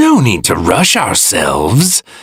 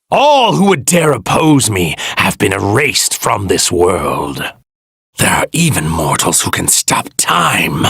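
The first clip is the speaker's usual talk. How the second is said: usual speaking voice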